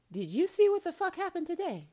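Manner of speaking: loud disbelieving cry to the sky; exasperated prayer. Shown as a demonstration. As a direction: yell it up at heaven like venting to an old friend. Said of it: "you obviously won't say it like I do".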